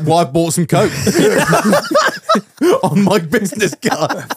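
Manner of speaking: giggling through the words, slow; a joke confession breaking into laughter; laughing at his own joke; laughing mock confession.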